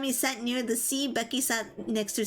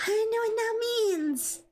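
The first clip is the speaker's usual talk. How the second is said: squeaky mock confusion; 11 semitones higher than usual. Repeats line in squeaky voice.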